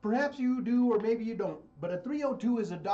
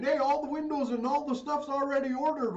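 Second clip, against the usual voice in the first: higher and louder mocking boast; mock Trump quote; 9 semitones higher, 4 dB louder than usual. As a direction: lampoon him bragging, pitched up. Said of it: "And now he's saying".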